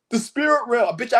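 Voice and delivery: usual speaking voice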